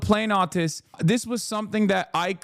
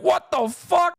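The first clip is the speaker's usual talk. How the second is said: much higher, startled "what the fuck"; mock startled outburst; 11 semitones higher, 3 dB louder than usual. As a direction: play the soldier caught off guard, half-joking. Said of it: "you're like".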